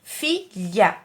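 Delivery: "Filha" in two short separate beats; syllable split. Shown as a demonstration. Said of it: "Duas sílabas". ("Filha" em duas sílabas curtas)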